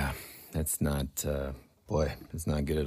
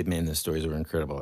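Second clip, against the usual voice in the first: fast; 3 semitones lower, 7 dB quieter, faster than usual. Shown as a demonstration. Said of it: "just say".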